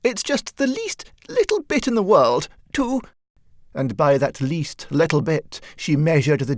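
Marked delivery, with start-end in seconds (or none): 0.0-3.0 a client faltering before her dressmaker, pitched high. A narrator's reading. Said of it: "she faltered".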